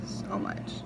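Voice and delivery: unvoiced trailing whisper. Real, not acted. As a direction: drop to a breathy whisper. Trailing whisper after still working.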